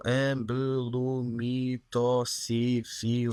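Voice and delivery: drawn-out, halting syllable-by-syllable reading of ambelumitoxifil; child stumbling through a medicine leaflet word; sounding out syllables. Retold or imitated. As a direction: spell out ambelumitoxifil slowly like a small child decoding a word. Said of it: "estava a juntar as sílabas".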